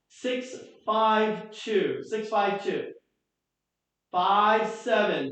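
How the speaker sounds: slowly spelling out the digits; slow, careful number dictation. Shown as a demonstration. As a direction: read each number slowly so people can write it down. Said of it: "ready? Here we go".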